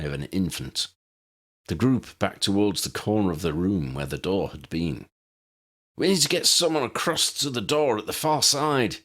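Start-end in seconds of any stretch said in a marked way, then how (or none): 6.0-9.0 half shout. A narrator's reading. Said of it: "Harry half shouted".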